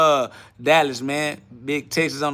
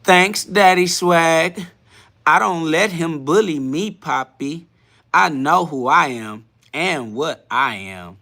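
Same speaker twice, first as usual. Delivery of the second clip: exaggerated, drawn-out mock accent; Demon Time's accent; slower than usual. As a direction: read it slowly in the commenter's put-on accent. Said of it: "You hit that accent a little too accurate".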